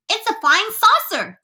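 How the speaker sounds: high-pitched crowd shout; excited shout. A narrator's reading. Crowd below spots the strange object, shouting excitedly. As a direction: cry it out high in wild excitement. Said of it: "they shouted".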